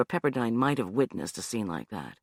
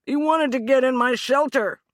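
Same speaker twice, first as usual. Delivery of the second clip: high, loud, rushed blurt; 11 semitones higher, 6 dB louder than usual. Acted. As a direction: let it burst out. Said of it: "Hugh blurted out".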